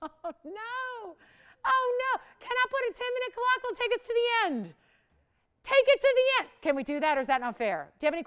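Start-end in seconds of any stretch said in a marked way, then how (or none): 0.0-6.5 high-pitched, dismayed pleading; high "oh no" as time runs out. Real, not acted. Clock running out, pleads for time.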